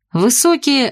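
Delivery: ordinary delivery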